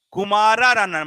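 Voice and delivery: loud, high punchline; loud drill. Shown as a demonstration. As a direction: call it out to the class. Teacher drills mnemonic loudly.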